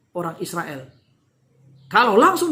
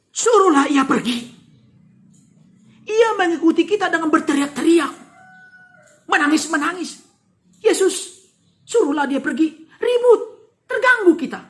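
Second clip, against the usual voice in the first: higher, irritated grumbling; fed-up disciples urging Jesus to send her away; annoyed complaint; 5 semitones higher, 4 dB louder than usual. Acted. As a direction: complain to get rid of her. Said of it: "berkata kepada Yesus".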